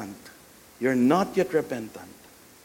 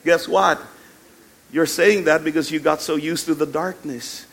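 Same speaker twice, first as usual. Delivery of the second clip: usual speaking voice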